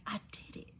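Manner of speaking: hushed whisper, barely any voice. Real, not acted. Telling viewers she faced her fear, whispered. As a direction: drop to a real whisper.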